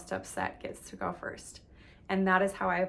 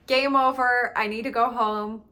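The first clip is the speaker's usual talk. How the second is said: louder, higher, cutting it off; fed-up declaration; 4 semitones higher, 8 dB louder than usual. Retold or imitated. Giving up on a ruined date, loud and final. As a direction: slam the door on the date.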